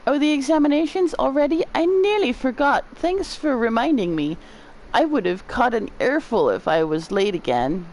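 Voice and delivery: a hint of flustered remembering; forgetful game character. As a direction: start high and quick, then settle.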